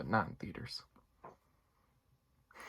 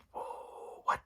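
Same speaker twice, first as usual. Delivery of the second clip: stunned whoa; 4 dB quieter than usual. Retold or imitated. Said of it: "pretty much verbatim my reaction".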